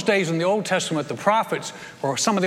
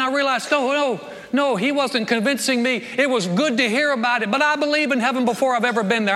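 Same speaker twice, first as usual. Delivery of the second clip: raised, fast preaching build; 6 semitones higher than usual. Real, not acted. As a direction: preach it with rising conviction. Preaching at full force, raised.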